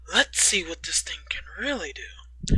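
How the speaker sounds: hushed eager whisper; low, secretive whisper. Acted. Character revving up ATV, whispered.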